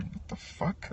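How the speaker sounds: unvoiced whispered what-the; whispered disbelief. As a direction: breathe it out in a hushed whisper. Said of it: "I'm like".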